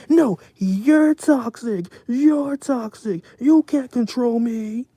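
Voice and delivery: high, loud, slow whiny mock of a defensive friend. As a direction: throw a shrill tantrum as the ungrateful friend, slow. Friend's outburst voiced mid-story.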